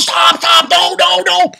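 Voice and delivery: high, loud panicked plea to stop; urgent shout. Retold or imitated. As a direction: yell out a frantic warning. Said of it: "his father says".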